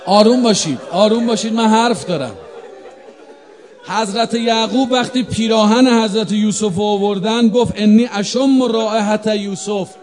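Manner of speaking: loud, ringing pulpit preaching; a preacher pressing his point to a stirred congregation; preaching at full force. Acted. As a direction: push it out over the crowd.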